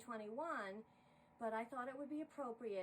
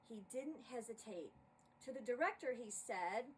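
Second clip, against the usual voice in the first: lively rhyming poem read aloud; poem recital; 4 semitones higher, 4 dB louder than usual. A narrator's reading. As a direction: narrate the verses with bounce and rhythm. Reads a holiday poem aloud.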